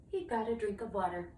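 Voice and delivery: ordinary delivery, nothing marked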